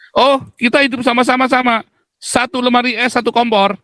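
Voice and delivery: high sing-song imitation of a cheerful neighbor; high mocking voice. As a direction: mimic the neighbor high and light. Quoting Chinese neighbor's reply.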